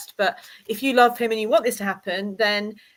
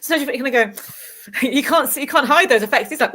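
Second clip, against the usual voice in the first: usual speaking voice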